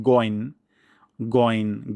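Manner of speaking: গইন with a plain hard g, short; wrong goin. Shown as a demonstration. Teacher showing a common error, short hard-g গইন. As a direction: say গইন with an everyday g, no throat friction. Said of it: "এই উভয়টি ভুল".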